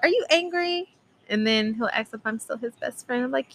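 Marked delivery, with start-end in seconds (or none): 0.0-0.9 put-on high-pitched little-kid questioning; little boy. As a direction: imitate a small son checking on mommy. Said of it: "sometimes he be like".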